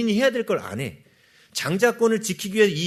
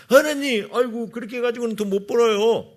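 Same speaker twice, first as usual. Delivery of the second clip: higher, louder mock complaint to God; whining protest; 6 semitones higher, 4 dB louder than usual. Believer objecting to God about honest earnings, whining protest. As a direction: act out an exasperated believer's objection.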